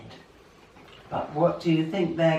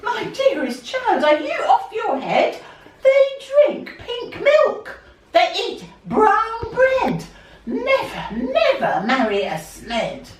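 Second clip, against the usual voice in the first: much higher and louder mock shriek; hammed-up scandalised granny; outraged grandmother; 12 semitones higher, 6 dB louder than usual. A narrator's reading. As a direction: grandmother scolding her dearest child, high and loud. Grandmother forbids the wedding.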